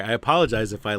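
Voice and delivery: ordinary talk, nothing marked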